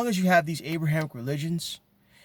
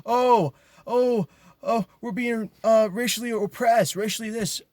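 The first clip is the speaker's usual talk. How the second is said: higher, hammed-up whiny cry of oppression; mock whining; 8 semitones higher than usual. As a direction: parody them crying out, pitched up. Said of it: "you wanna cry out".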